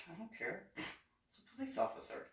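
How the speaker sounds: high, soft, half-whispered aside; hushed aside. Retold or imitated. Retelling a reaction to angry companions, hushed aside. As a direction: drop to a small, hushed voice. Retold aside about police officer.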